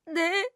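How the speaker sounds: high stifled sob; sob, then a small yes; frightened sob then yes. Acted. Terrified wife sobbing an answer, high stifled sob.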